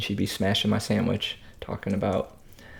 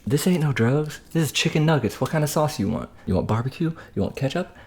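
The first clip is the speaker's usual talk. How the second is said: higher-pitched imitation of a counter lady; imitating older counter woman; 6 semitones higher than usual. Vlogger imitating the older woman bagging food, higher voice. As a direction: lightly mimic the elderly worker, pitched up. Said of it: "She was saying".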